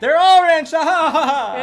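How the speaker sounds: loud high braggy laughing; booming laugh. Acted. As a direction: gloat and laugh big. Neighbor brags with booming laugh.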